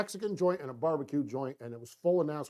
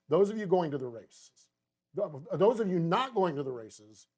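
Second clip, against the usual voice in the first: ordinary talk, nothing marked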